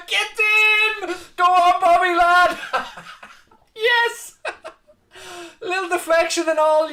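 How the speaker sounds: high, loud joyful shouting; overjoyed laughing yells; ecstatic celebration. Real, not acted.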